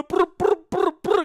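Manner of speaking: high rolled engine brr; playful motor noise; engine revving imitation. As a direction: rev it up with your lips.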